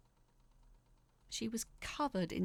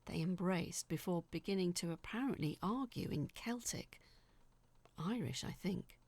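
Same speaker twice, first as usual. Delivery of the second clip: ordinary delivery, nothing marked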